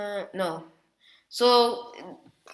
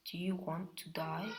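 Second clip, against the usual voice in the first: hushed, softer threat; quiet menace; 2 semitones lower, 14 dB quieter than usual. Acted. Naruto quietly threatening Sato, hushed menace.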